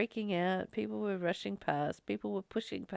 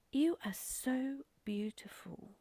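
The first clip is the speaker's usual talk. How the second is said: whispered, slow, imitating an awed admirer; hushed retold quote; 4 semitones higher, 5 dB quieter, slower than usual. Woman on the train greets a stranger up close, imitated slow whisper. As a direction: say it slowly in an imitated breath-only whisper.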